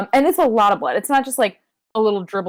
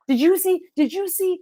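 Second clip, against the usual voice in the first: much higher, repeated frantic ask; mock panicked asking; 11 semitones higher than usual. Mocking Roddy and Bobby, high and frantic. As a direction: squeak it like a fake panic. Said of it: "Roddy and Bobby being like".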